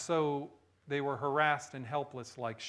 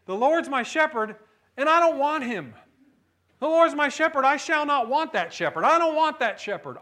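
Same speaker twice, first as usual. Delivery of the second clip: higher, louder indignant refusal of the shepherd; a child's comic misreading of the psalm, said with loud, high indignation; mock child's misreading; 9 semitones higher, 9 dB louder than usual. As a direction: loudly insist you don't want him. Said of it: "And he was saying".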